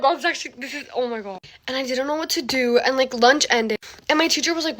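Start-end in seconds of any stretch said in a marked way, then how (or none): none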